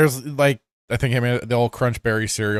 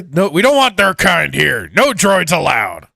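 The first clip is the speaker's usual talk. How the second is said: cantina bartender voice; 5 semitones higher, 3 dB louder than usual. Retold or imitated.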